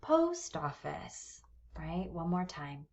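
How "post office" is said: "Post office" slowly, POST held longer and higher; stress on POST. Shown as a demonstration. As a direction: lean on "Post", let "office" drop away. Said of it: "first syllable of a compound noun is stressed".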